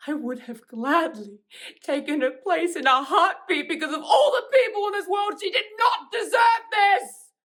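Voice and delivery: grief erupting into high, full-force scream; anguished screaming outburst. Acted. Grieving for a murdered sister before the killer, anguished scream. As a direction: break from controlled words into a tearful, high-pitched shout.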